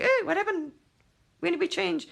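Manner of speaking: high, clueless mock surprise; mock bewilderment. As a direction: play it wide-eyed and clueless.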